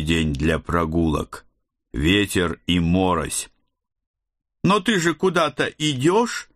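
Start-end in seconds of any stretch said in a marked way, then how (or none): 4.6-6.5 put-on high, pouting teen daughter; whiny capricious girl; bossy little princess. A narrator's reading. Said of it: "капризно надула губки моя дочь".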